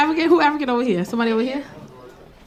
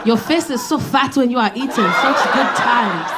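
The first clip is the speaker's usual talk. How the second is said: put-on African accent compliments. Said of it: "He was like".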